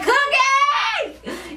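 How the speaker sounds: put-on high, drawn-out drunken call; drunken holler. Drunk Carol calling out to Cookie, high mock holler. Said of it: "she's just slurring her speech".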